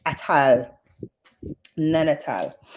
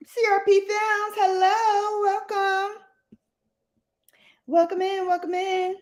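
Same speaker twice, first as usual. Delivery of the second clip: high, sustained singsong welcome; singsong greeting; 12 semitones higher than usual. Real, not acted. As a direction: sing out a hello to newcomers in a high voice. Greeting viewers who just joined.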